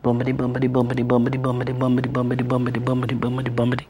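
soft, even chant of bumpity; tricycle sound. Retold or imitated. Teller imitating her quiet tricycle, soft rhythmic chant. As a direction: lull the tricycle noise quietly. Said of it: "You could just hear a little".

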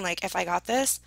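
ordinary talk, nothing marked

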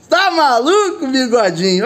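slow, laughing tease; laughing disbelief. Real, not acted. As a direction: chuckle it out at your mate. Laughing at drone clip.

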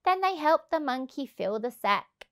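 ordinary delivery, nothing marked

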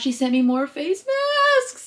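high, stretched-out excitement; excited squeal. Real, not acted. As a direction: draw it out, rising high with delight.

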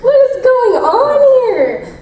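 very high, slow laughing what; shocked disbelief. Retold or imitated. Reacting to the heroine's thoughts, very high and laughing. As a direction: squeal it in shock. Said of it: "and you're like".

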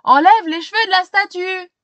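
loud, high command; stern order. Acted. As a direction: order the boy firmly. Chief orders Max.

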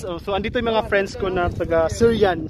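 ordinary talk

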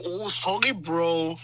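ordinary talk, nothing marked

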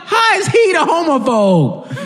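high, drawn-out disbelieving question; incredulous. Real, not acted. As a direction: laugh in outraged disbelief as you ask. Incredulous rant about show's scene.

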